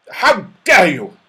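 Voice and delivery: loud, indignant outburst; outraged toy scolding rude visitors; in a rage. A narrator's reading. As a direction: explode with offended fury. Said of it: "cried the wobbly man in a rage".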